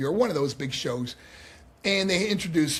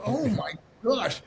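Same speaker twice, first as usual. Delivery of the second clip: slow, high-pitched astonishment; retold amazement; 6 semitones higher, slower than usual. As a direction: draw out a mock-stunned reaction. Retold overwhelmed reaction to coaching.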